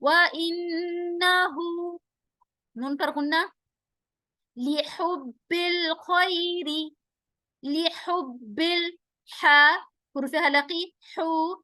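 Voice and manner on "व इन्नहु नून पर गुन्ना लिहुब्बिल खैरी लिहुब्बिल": slow drawn-out tajweed chant; slow Quranic recitation. Shown as a demonstration. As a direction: recite each syllable slowly, melodically. Recites verse between rules.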